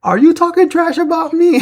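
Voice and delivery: her little voice. Retold or imitated. Wrestler confronting rival over trash talk, high little voice. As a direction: imitate her tiny voice with a rising question. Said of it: "hearing her little voice".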